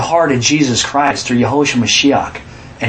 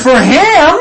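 much higher and louder, disbelieving; incredulous; 10 semitones higher, 5 dB louder than usual. Real, not acted. Preacher echoing a listener, loud disbelief. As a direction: throw it back at them, astonished.

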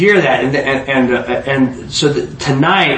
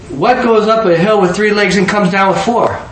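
higher, lightly acted questioning; eager riddle asker; 6 semitones higher than usual. Einstein asking the riddle back before they go, insistent. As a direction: ask it high and insistent.

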